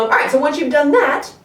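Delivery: ordinary talk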